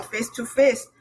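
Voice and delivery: usual speaking voice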